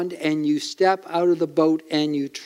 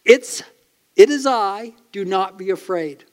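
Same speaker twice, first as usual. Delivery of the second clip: raised, gentle reassurance from Jesus; 3 semitones higher, 4 dB louder than usual. Acted. Preacher voicing Jesus calming frightened disciples, reassuring. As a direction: call out calmly over the storm.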